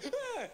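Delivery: high, short mock scream; squealed fake fright; startled child's yelp. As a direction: imitate a small child's shriek. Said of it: "It wasn't like".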